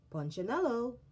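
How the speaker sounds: the maker calling him; deep strong voice. Acted. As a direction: call his name firmly. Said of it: "The voice was deep and strong".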